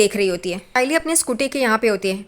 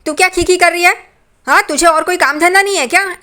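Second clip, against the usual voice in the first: higher, louder mock yell at the daughter-in-law; imitated yelling; 7 semitones higher, 4 dB louder than usual. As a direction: retell the shouting with a raised pitch. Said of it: "Renuka साइली पे चिल्लाना शुरू कर देती है". (ऊँची आवाज़ में नकली चिल्लाना)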